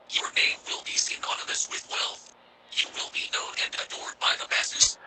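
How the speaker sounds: soft, breathy whispered voice of a many-mouthed being; tempting creature whisper. Acted. The creature tempting Rothman with power, eerie whisper. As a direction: lure him with whispered promises.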